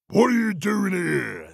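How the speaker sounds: troll's high, booming challenge. A narrator's reading. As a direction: demand it in a big troll voice. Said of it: "said the Troll".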